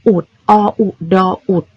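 อุด closed with d, spelled o-u-do-ut; spelled-out d ending. Shown as a demonstration. Teacher reminding pupils of the forgotten letter, syllable spelled out. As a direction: spell out อุด, stopping the vowel with a d. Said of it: "อย่าลืมตัว".

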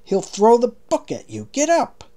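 urgent warning. A narrator's reading. King urgently warning Marlowe.

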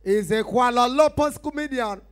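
booming high-pitched show introduction; big loud MC announcement; event announcer. Acted. MC introducing a touring comedian to the crowd, booming announcer.

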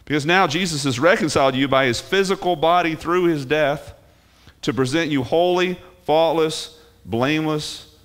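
high, emphatic Bible quotation; emphatic scripture proclamation. Acted. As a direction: proclaim the verse high, punching key words. Proclaims scripture at raised pitch.

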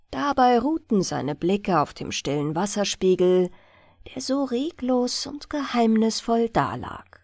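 ordinary delivery

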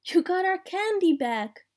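put-on high, delighted little-girl exclamation; excited exclamation. A narrator's reading. Vampire girl getting her candy back, excited exclamation. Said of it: "exclaimed the vampire girl".

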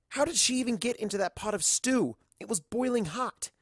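disbelieving narrator; incredulous. Acted. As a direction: marvel at the absurdity.

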